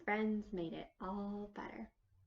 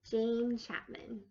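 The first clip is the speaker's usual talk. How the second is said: ordinary delivery, nothing marked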